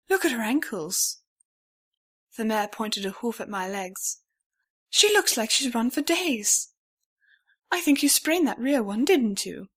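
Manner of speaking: lightly voiced worried older mare; the mare fusses over the stranger's ankles; concerned mare. A narrator's reading.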